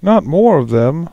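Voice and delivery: high, loud whiny dismay; Fallon dismayed. Acted. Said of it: "Fallon's just like".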